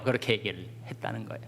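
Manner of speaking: ordinary talk, nothing marked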